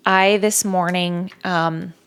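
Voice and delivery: ordinary delivery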